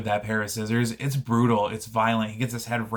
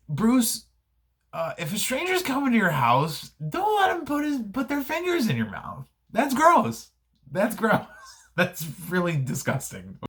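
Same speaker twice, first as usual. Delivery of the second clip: high, hammed-up scolding of a little kid; mock-parental telling off, much higher; 11 semitones higher than usual. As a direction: wag a finger at young Bruce, squeaky and appalled. Scolding young Bruce Wayne.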